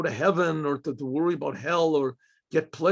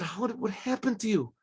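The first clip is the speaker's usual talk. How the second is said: higher and softer, close to crying; grieving judge asks his friend what happened, higher and softer; tearful asking; 5 semitones higher, 5 dB quieter than usual. Retold or imitated. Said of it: "he starts to weep".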